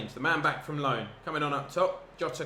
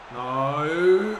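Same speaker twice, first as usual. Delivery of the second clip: loud cry of dismay; a loud, despairing no; anguished no; 2 semitones higher, 10 dB louder than usual. Real, not acted. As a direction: yell it as the ball nearly goes in. Near goal against him.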